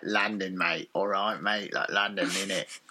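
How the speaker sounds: low, soft London geezer; low East End London accent. Shown as a demonstration. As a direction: talk like a London lad. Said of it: "Can you do that voice?".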